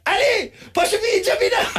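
mock yell, pitched high and laughing; imitated shout across the dance floor. Friend shouting to Ali mid-dance, laughing. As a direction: call out through laughter like the friend did. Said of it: "se huusi vaan mulle silleen".

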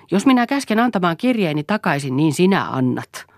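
enraged husband shouting for his letters. Acted. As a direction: yell the demand at her. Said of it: "huusi Eero".

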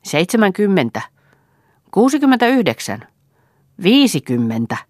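loud auction bids, mostly pitched high; called-out bids. A narrator's reading. Bidders bidding for the boy, calling out loudly. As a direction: call out rival bids.

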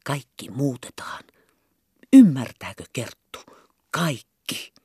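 secretive whisper; hushed conspiratorial whisper. Acted. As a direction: whisper it so no one overhears. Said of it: "puhua kuiskaamalla".

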